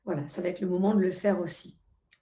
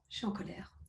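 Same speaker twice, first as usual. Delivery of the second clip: barely voiced, much softer; 11 dB quieter, faster than usual. Shown as a demonstration. Coach naming her anger in a tapping round, very softly. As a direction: say it almost to yourself. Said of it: "On va dire".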